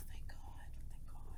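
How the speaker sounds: very soft, under-the-breath thanks; whispered relief. Real, not acted. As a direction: whisper your relief to yourself. Relieved aside after escaping punishment.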